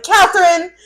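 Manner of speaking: retold scolding shout of a name; sharp call. Friend cutting off spiraling with a name, sharp call.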